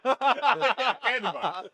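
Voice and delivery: slow, high laughing drawl; laughing silly voice. Acted. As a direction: laugh through it in a goofy voice. Laughing character voice.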